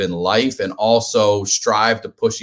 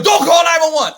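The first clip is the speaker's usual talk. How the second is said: high, loud shout breaking into laughter; laughing shout; 5 dB louder than usual. Retold or imitated. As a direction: yell it urgently while laughing. Shouting to girl on the phone.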